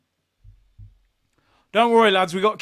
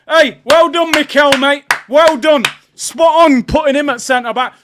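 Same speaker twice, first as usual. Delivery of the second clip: loud, mocking well done, higher and louder; raised, scornful congratulations; sarcastic praise; 5 semitones higher, 8 dB louder than usual. Real, not acted. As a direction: jeer at the manager with fake praise.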